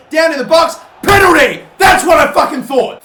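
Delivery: loud, high yell at the penalty call; outraged shout. Real, not acted. Gamer outraged as a penalty goes against him, angry shout. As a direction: blow up at the referee's decision.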